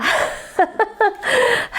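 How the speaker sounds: a very high, startled squeal of laughter. Real, not acted. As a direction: laugh out in shocked delight. The cat climbs onto the plants. (rire aigu et surpris)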